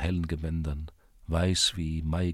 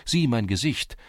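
usual speaking voice

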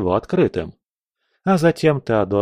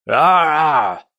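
high, loud drawn-out scream; villain's yell; 5 semitones higher, 5 dB louder than usual. A narrator's reading. Villain dragged into the rift, yelling. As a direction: scream out as if being yanked away. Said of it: "заорал он".

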